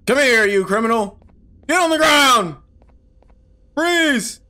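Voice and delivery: high, loud cop commands. Pretend. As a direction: bark orders like a sheriff. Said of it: "Sheriff Toadster's mission".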